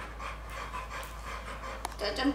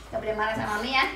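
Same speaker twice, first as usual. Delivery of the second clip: normal talking voice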